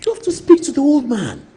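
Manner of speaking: hammed-up pushy colleague urging; high pitched mock nagging about the bill; imitating Brother Fo. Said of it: "He told me that".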